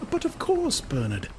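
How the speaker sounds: high woman's voice, hasty reassurance; a woman insists it's only the place; Lenina reassuring. A narrator's reading. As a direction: soothe him quickly. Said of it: "Lenina reassures".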